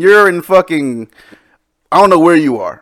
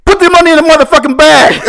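higher, louder hammed-up robber shout with laughter; mock yell; 9 semitones higher, 4 dB louder than usual. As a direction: shout the hold-up line through laughs. Retelling the kid's robbery yelling.

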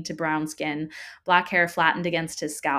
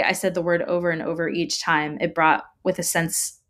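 plain reading voice

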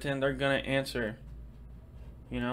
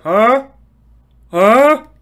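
high, very loud "Huh? Huh?"; obnoxious huh; 9 semitones higher, 19 dB louder than usual. Retold or imitated. Him as the obnoxious listener, high and very loud. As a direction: blare it out obnoxiously. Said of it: "obnoxiously said".

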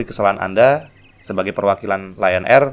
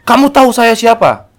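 high, loud pompous challenge; high loud imitated prosecutor; arrogant official; 14 semitones higher, 10 dB louder than usual. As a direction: puff yourself up as an entitled official.

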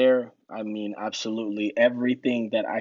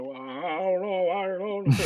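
mock whimpering; 8 semitones higher than usual. Mocking the sailors who backed out, whimpering. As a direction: fake a scared whimper.

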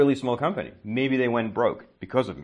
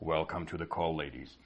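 much lower, softer put-on host voice; smooth call host; 6 semitones lower, 12 dB quieter than usual. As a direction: greet them low and quiet, mock-suave. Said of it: "I clear my throat".

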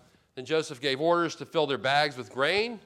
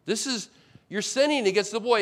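Reuben pleading; 7 semitones higher, 6 dB louder than usual. Retold or imitated. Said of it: "and he says".

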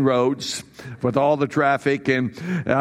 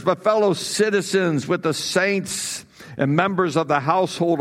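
raised, formal reading of scripture; 4 semitones higher than usual. Acted. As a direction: proclaim the passage to the church. Reads scripture aloud.

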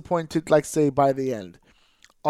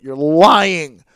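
firm, louder accusation; accusing; 8 dB louder than usual. Real, not acted. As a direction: call them out flatly. Ranting at fans of show.

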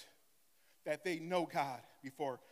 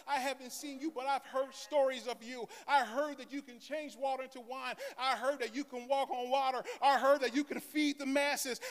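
raised, earnest pleading; a hint of a pleading blind man; 7 semitones higher than usual. Blind man telling Jesus he is desperate, mild pleading. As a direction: plead steadily to be heard. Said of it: "began to scream and cry out".

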